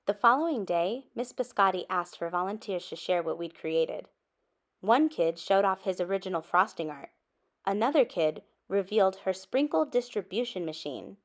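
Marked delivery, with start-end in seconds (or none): none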